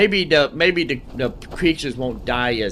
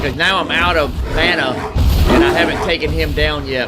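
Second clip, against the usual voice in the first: louder and faster, caught up in a tough fight; tense, louder play reaction; 8 dB louder than usual. Real, not acted. Streamer to viewers while losing a fight, loud and tense. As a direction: keep pushing the words out while losing.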